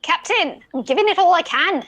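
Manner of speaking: put-on vague Scottish pirate accent. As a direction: give it all you've got, in a mock Scottish brogue. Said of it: "I don't know what accent I'm doing".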